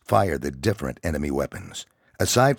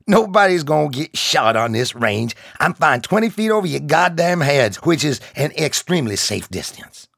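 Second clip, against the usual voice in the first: higher, louder gruff sergeant voice; gruff drill sergeant; 11 semitones higher, 6 dB louder than usual. Acted. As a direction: bark reassurance at scared recruits. Sergeant reassures recruits.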